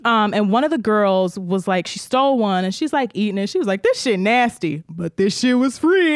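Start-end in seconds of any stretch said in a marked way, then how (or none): 3.8-6.1 slow, drawn-out mock sass; imitated brazen girl. Girl eats the stolen candy, mock brazen. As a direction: stretch it out with lightly hammed-up insolence. Candy thief sneers while eating.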